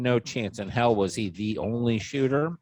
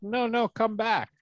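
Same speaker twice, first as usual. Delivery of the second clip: imitated calling back; 10 semitones higher than usual. Acting out those calling agents back.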